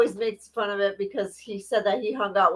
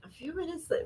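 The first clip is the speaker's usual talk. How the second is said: soft, higher-pitched put-on voice; Priscilla voice; 7 semitones higher, 11 dB quieter, faster than usual. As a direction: gently mimic a little Priscilla voice. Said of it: "her best Priscilla voice".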